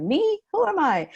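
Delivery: puzzled quote. Retold or imitated. Young lady on podcast asked about herself, mock-baffled high voice. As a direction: play her caught off guard by the question, high and baffled. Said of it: "But she's like".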